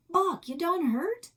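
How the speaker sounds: high, loud worried call; hammed-up frontier worry; mock alarm. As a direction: call out to the fallen partner in play panic. Partner found floating in river.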